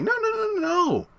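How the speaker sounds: high, squealing no no no; eager insisting. Retold or imitated.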